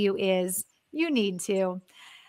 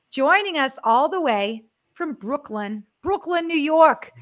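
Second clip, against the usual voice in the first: a clumsy mock New York accent, louder and higher at the end; bad New York accent; 4 semitones higher, 5 dB louder than usual. Host introducing a Brooklyn guest, fake New York accent. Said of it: "That was the worst New York accent".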